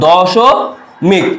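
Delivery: দশমিক stretched out very slowly, syllable by syllable; slowly drawn out. Shown as a demonstration. Tutor to students, dwelling on the word দশমিক slowly. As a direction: draw out দশমিক so the দশ stands out. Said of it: "খেয়াল করো, প্রথমে যদি বলি".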